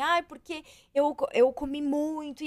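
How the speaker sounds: retold guilty whine; guilty lament. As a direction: act out mild after-binge guilt. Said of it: "eu ficava muito assim".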